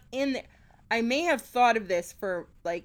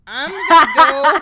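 loud laughing answer; 5 semitones higher, 17 dB louder than usual. Real, not acted. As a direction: blurt it out through a laugh.

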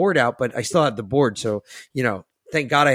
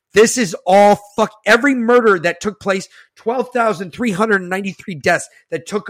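louder, higher furious rant; angry outburst; 5 semitones higher, 4 dB louder than usual. Real, not acted. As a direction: let the outrage explode. Raging at Fauci.

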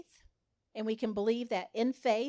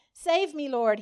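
higher, louder cry for help; desperate cry; 5 semitones higher, 9 dB louder than usual. A narrator's reading. Sinking Peter crying out to Jesus for rescue. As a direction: cry out as you're going under. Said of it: "he cried out".

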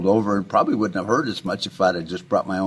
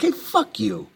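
much higher, mock-defiant retort; 12 semitones higher than usual. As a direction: fling it back as a taunt, pitched way up. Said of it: "They're like".

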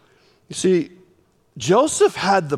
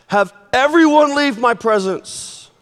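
crying out; 6 semitones higher, 4 dB louder than usual. Retold or imitated. Joseph cries out to attendants.

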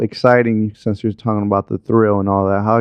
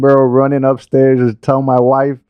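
higher-pitched, excited storytelling; excited retelling; 5 semitones higher than usual. Retold or imitated. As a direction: tell it like you ran upstairs with big news. Excited retelling of watching the game.

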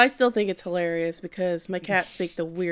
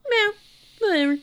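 a higher, dismissive cat shrug; mock cat indifference; 13 semitones higher than usual. Cat Haru to Subaru, higher dismissive mock indifference. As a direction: brush it off like a bored cat. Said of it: "Haru's like".

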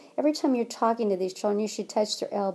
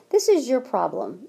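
ordinary talk, nothing marked

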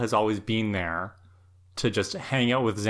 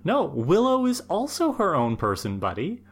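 raised, higher pitch, telling him off; indignant mock lecture to Xander, pitched up; mock scolding; 8 semitones higher than usual. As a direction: put the clueless friend in his place. Scolding Xander on Willow's behalf.

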